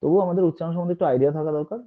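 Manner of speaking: usual speaking voice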